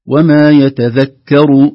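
"يتذكر" with a light, thin kaf before the ra, slow and loud. Shown as a demonstration. Teacher reciting slowly to show the light kaf. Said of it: "الكاف ترقق".

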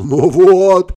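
loud, strained wheeze after a punch; wheezing. A narrator's reading. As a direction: choke it out, winded and hurt. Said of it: "захрипел".

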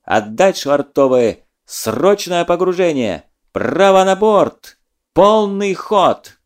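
slow, loud, rising naval orders; shouted commands. Acted. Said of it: "Из переговорных труб доносились слова команд".

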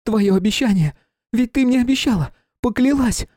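high, loud pleading cry; desperate plea. Acted. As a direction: call out in desperate appeal. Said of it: "воззвала к королеве".

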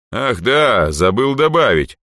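sudden recollection. A narrator's reading. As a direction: exclaim as if it just came to mind. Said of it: "словно что-то вспомнив только сейчас".

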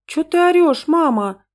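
put-on high, whiny child's voice; mock-tearful daughter, loud and high. A narrator's reading. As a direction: whimper up high like an upset little girl. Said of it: "всхлипнула дочь и вдруг разразилась рыданиями".

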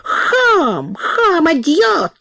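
put-on high, slow accented scream of insults; frightened yelling. A narrator's reading. Cook yells insults at Prokhor while retreating, high scared shout. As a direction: yell while backing away scared. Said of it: "заорал и мистер Кук, испуганно пятясь".

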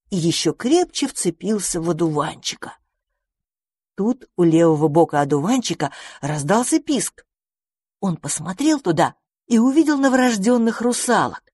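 ordinary delivery, nothing marked

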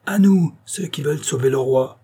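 urgent shout to the crowd. A narrator's reading. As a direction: call the crowd to rescue the king. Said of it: "en criant".

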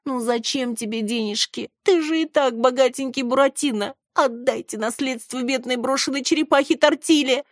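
high, pleading put-on little voice; pitiful wheedling. A narrator's reading. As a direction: whine pitifully like a beggar. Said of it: "постаралась принять жалостливое выражение лица".

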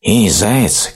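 high storybook call from a hill; bear cub calling out. A narrator's reading. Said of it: "крикнул с холма медвежонок".

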